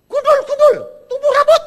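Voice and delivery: very loud, high laughing declaration; declaring over a bald head. Retold or imitated. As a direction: proclaim it loudly, laughing. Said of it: "Terus omongin". (mengajari anak muda berseru, keras dan tinggi sambil tertawa)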